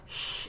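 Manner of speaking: a bare hushing "sh" for the ich-laut; mistaken sh sound. Shown as a demonstration. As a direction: hush "sh", as the wrong sound. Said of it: "it's not that".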